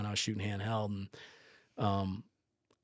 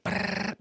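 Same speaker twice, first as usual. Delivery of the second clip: rapid-fire shutter noise made by mouth; imitated camera burst; 4 dB louder than usual. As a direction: rattle off a motor-drive trill. Imitates camera firing frames.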